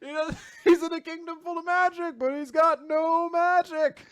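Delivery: very high, squeaky mock complaint; exasperated comic rant. Podcaster as Seke griping about Asta's recognition, exasperated comic rant. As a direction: push it into a hammed-up falsetto gripe.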